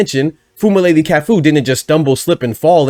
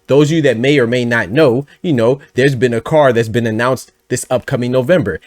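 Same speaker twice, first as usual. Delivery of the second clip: ordinary delivery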